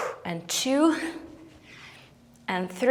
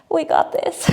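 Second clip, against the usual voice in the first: laughing; 6 semitones higher than usual. Real, not acted. Laughing while straining on reps.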